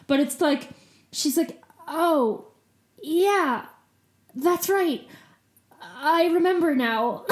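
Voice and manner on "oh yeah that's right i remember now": put-on stoned teen murder suspect. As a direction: play the spaced-out teen girl suspect. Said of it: "every teen girl murder suspect from Law and Order".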